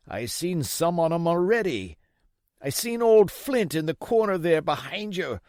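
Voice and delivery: put-on old pirate begging Jim for rum; old sea captain. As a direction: play the sick old buccaneer wheedling the boy.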